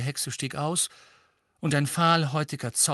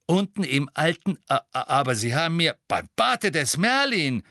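higher, louder and stammering in alarm; shocked outburst; 4 semitones higher, 4 dB louder than usual. A narrator's reading. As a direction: blurt it out in sudden panic. Shocked realisation of lateness.

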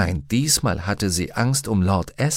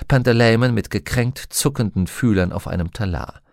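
ordinary delivery

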